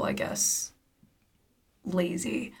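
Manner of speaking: quiet unvoiced whisper; whispered aside. Real, not acted. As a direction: drop to a whisper.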